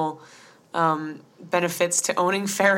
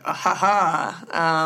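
slow, drawn-out "ah, ha, ha"; knowing mock chuckle; 3 dB louder, much slower than usual. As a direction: imitate a salesman's scheming chuckle, slowly. Retold salesman's reaction to a budget.

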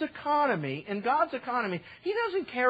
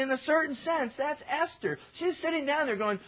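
usual speaking voice

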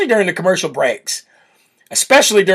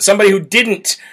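normal talking voice

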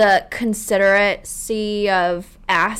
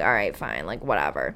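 a much lower, quieter "all right, fine, like, whatever"; low, soft shrug-off; 12 semitones lower, 6 dB quieter than usual. Retold or imitated. Imagining shrugging off the driver, low and quiet. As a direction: brush it off low and quiet, no big deal.

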